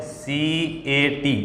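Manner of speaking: letters spelled out one by one, slowly; slow spelling. Shown as a demonstration. Teacher spelling a word letter by letter for students, slowly.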